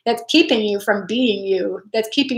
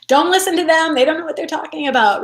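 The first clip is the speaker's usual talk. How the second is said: higher, brisk reassuring mock parent; protective parent; 6 semitones higher, faster than usual. Parent telling their kid to ignore bullies, higher and quick. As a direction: tell your kid to ignore them, a bit faster. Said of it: "you'd just be like".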